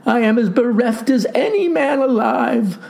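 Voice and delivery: high-pitched, tearful lament; weeping. A narrator's reading. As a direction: bewail it pitifully as a broken lad. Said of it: "wept the lad".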